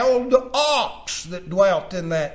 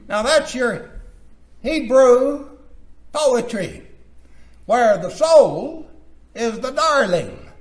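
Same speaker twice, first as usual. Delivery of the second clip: full-force preaching; 6 semitones higher, 8 dB louder than usual. Acted. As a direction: preach it out in short phrases with long pauses.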